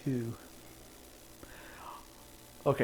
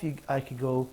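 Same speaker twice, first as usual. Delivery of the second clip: ordinary talk, nothing marked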